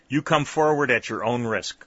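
cried warning. A narrator's reading. Sergeant warns Skelly's men off, loud cry. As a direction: call out a firm threat. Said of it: "cried the sergeant".

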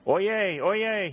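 high, loud crier's call; town crier. A narrator's reading. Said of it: "he cried".

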